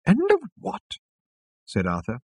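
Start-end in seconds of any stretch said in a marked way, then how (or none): 0.1-1.0 very high-pitched query; astonished. A narrator's reading. Arthur hearing the restaurant's name, astonished high voice. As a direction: squeak it in disbelief.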